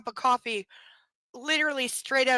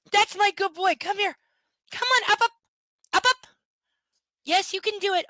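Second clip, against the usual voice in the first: higher and louder, sing-song coaxing; 7 semitones higher, 6 dB louder than usual. Real, not acted. Owner coaxes dog out of his cage, sing-song. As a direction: coax him out of the cage.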